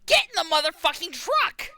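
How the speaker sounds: loud, high-pitched yell; full-force battlefield shout; screamed order. Acted. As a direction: scream it over your shoulder at them. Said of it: "screams in Zentradi".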